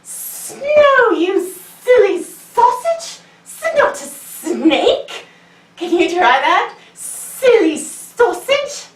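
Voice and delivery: high, drawn-out teasing snake voice; slow high playful snake voice; hissy snake. Acted. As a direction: stretch it slowly like a cheeky snake. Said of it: "the snake looks at him and says".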